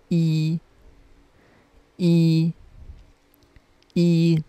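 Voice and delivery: E held high and level in first tone. Shown as a demonstration. Teacher reading number one to learners, E in high level first tone. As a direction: say E as a long, steady high note. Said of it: "it is in the first tone".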